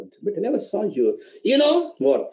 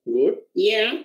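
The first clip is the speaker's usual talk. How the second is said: ordinary talk, nothing marked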